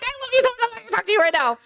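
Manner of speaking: very high, squeaky sibling banter; high-pitched playful fake huff; mock-exasperated affection. Replays affectionate, laughing sibling banter.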